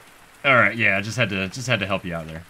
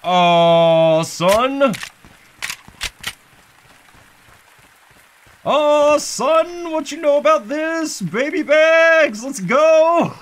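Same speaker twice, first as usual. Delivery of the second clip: high, loud hype yells; hyped shouting; 11 semitones higher, 5 dB louder, much slower than usual. Real, not acted. Gamer hyping up the baby's gun, loud cheers. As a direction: get pumped over the new gun.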